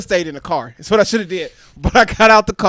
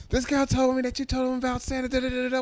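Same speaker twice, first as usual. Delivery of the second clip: scolding mom; 5 semitones higher, 3 dB quieter than usual. Retold or imitated. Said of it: "I answer the phone".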